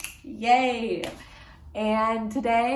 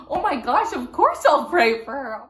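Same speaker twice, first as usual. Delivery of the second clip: higher and louder, delighted; excited; 5 semitones higher, 8 dB louder than usual. Retold or imitated. Vlogger promises to pray for the granddaughter, excited, higher and louder. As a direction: gush with joy.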